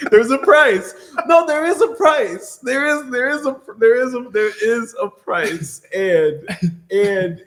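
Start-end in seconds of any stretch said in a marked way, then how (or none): 0.1-5.8 laughing insistence. Real, not acted. Laughing, insisting there's a price.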